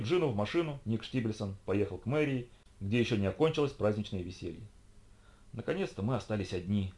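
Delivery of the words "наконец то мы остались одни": ordinary delivery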